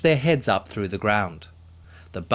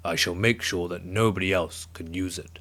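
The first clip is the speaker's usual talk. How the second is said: giant mumbling; 4 semitones lower, 3 dB quieter than usual. A narrator's reading. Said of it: "the giant mumbled to himself".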